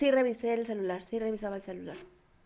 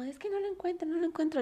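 put-on higher, a touch of drunken panic; half-mock worry, searching for him; panicked search; 7 semitones higher, 5 dB quieter than usual. Drunk girl at a party looking for her boyfriend, higher anxious voice. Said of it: "salí así como".

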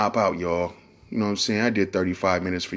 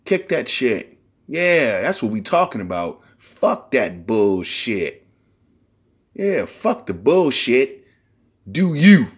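hyped coworker; 3 semitones higher, 3 dB louder, much slower than usual. Retold or imitated.

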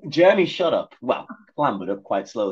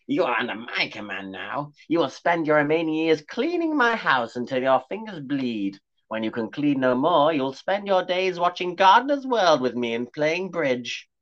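put-on old witch crone; 5 semitones higher than usual. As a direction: draw out students like a hungry hag.

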